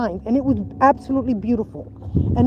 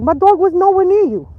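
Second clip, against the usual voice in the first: retold protest; 6 semitones higher, 3 dB louder than usual. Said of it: "I'm looking at her like".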